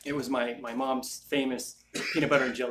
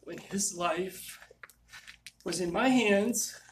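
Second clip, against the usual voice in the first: grief-stricken; 6 semitones higher than usual. Real, not acted. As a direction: struggle to get the words out, fading to almost nothing. Grieving friend he couldn't save.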